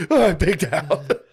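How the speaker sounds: high, laughing imitation of himself wasted; mock stoned self. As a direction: play yourself too high to function, giggling. Mocking his own high state.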